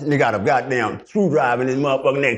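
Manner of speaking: ordinary talk, nothing marked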